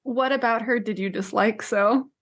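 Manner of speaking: ordinary delivery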